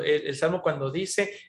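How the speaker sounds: usual speaking voice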